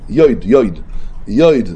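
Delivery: יויד said "yoid", with an oi glide; holam vowel. Shown as a demonstration. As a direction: pronounce each יויד as "yoid". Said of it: "זה חוילם".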